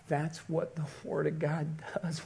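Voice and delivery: breathy, whispered amazement; hushed awe. Real, not acted. Preacher moved by a couple's tearful story, hushed. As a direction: drop to a near whisper.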